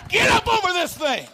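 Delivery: rising to a high, loud shout; building shout. Retold or imitated. Preacher voicing the Holy Ghost urging the believer on, building shout. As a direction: push them over the hill, shouting. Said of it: "the Holy Ghost is behind you".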